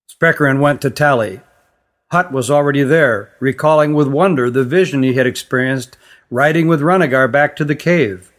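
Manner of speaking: ordinary delivery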